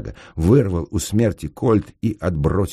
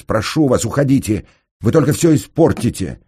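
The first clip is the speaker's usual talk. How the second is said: raised, higher pleading voice; urgent plea; 7 semitones higher, 8 dB louder than usual. Acted. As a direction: beg loudly for them to go. Engineer pleads with her to leave. (громко, настойчиво упрашивая)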